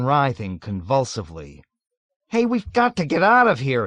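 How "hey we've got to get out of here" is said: high, loud panic; panicked cry. A narrator's reading. Nately crying out trapped in the overturned jeep. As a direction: cry out in alarm. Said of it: "he cried".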